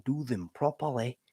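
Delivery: soft mock English accent; put-on English accent. Shown as a demonstration. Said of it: "to do these accents".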